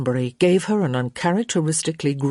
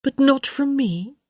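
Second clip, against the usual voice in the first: high, questioning mock hurt; feigned disappointment; 10 semitones higher than usual. A narrator's reading. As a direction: try to sound let down. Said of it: "trying to sound disappointed".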